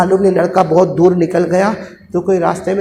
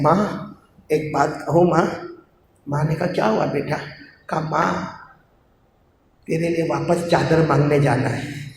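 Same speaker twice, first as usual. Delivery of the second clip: put-on soft, low child voice; soft boy; 4 semitones lower, 9 dB quieter than usual. Son softly telling his mother he must go again, quietly. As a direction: tell her gently, barely aloud.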